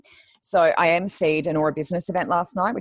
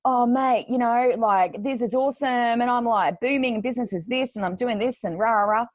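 higher, puffed-up boasting mate; bragging bloke; 6 semitones higher than usual. Retold or imitated. Mocking the brokers who bragged to the speaker, in a higher puffed-up voice.

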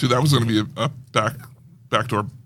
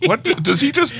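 mock confused coworker; 7 semitones higher than usual. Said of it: "people who work here are like".

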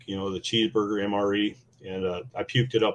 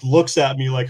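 normal talking voice

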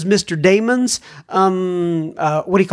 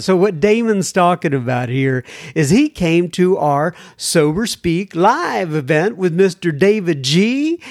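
ordinary talk

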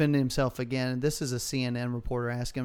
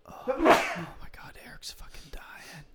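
hushed mock-alarmed whisper; breathless whispered panic, half joking; 5 semitones higher, 4 dB quieter than usual. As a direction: whisper it like a joke emergency. Mock alarm about sleeping friend.

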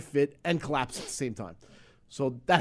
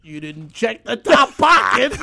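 slow, loud, higher accusing voice; wife's accusation; 7 semitones higher, 11 dB louder, slower than usual. Retold or imitated. Wife catching him, slow loud accusation. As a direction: drag out the accusation. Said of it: "then I throw the bag".

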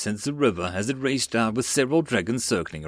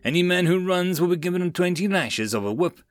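higher-pitched commanding threat; roared threat; 6 semitones higher than usual. A narrator's reading. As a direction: threaten the troops as their commander. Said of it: "hearing the threat".

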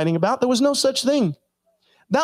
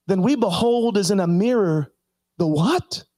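normal talking voice

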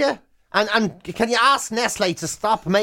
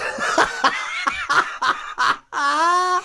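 long laugh; 8 semitones higher than usual. Real, not acted. Laughing at petition.

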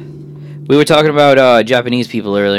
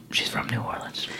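whispering; 16 dB quieter than usual. Real, not acted. Revealing a secret aside.

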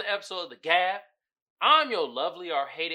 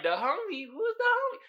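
confused; 12 semitones higher than usual. Retold or imitated. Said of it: "I was like".

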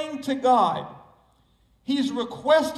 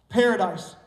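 ordinary talk

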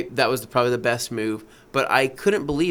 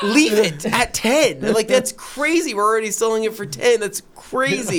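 exasperated plea; 7 semitones higher than usual. Retold or imitated. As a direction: beg to keep the price. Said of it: "And I'm like".

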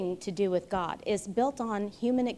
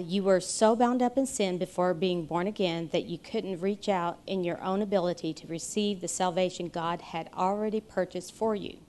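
usual speaking voice

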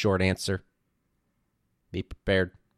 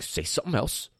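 mock arguer; 8 semitones higher than usual. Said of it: "when some people argue too, they, like, clap their hands".

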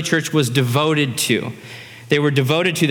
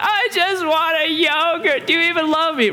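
put-on tearful child; 13 semitones higher than usual. Daughter begging dad for yogurt, mock tearful. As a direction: imitate a child pleading through tears. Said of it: "they're in tears".

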